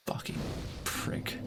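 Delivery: soft, breathy muttered swearing; muttered curse. Real, not acted. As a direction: curse it under your breath.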